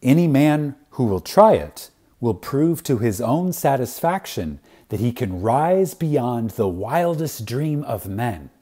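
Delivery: usual speaking voice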